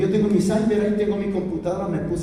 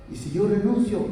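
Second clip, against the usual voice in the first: ordinary talk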